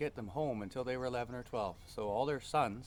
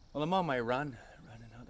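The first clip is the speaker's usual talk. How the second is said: ordinary delivery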